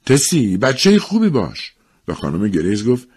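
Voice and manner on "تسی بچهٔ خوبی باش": drawn-out, higher-pitched call; mild acted call. A narrator's reading. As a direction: call over to a friend to behave. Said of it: "صدا زد".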